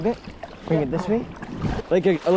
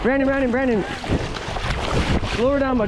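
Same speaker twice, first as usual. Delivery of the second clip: fighting a fish; 6 semitones higher, faster than usual. Real, not acted. Urging during fish fight.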